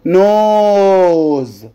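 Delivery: "نوز" with a long, held O; long vowel. Shown as a demonstration. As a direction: stretch the O in "نوز" very slowly. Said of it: "بمد في الاو هنا". (مد حرف الـ o في "نوز")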